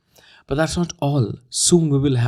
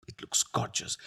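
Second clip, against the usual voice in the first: whisper; 8 dB quieter than usual. Real, not acted.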